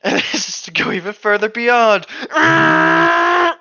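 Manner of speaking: high, loud straining battle cry; powering-up scream. Acted. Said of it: "Ow, my throat".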